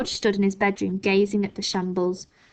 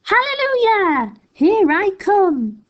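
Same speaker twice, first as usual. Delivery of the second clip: high, loud, slow cackling crone cry; triumphant granny shrieking as she grows; screechy old grandma; 12 semitones higher, 6 dB louder, slower than usual. A narrator's reading. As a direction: crow it out like a wild old woman. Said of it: "finding her voice at last".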